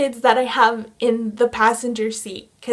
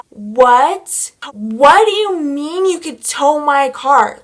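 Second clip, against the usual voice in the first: outraged disbelief; 3 semitones higher, 5 dB louder, much slower than usual. Retold or imitated. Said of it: "I lost my shit".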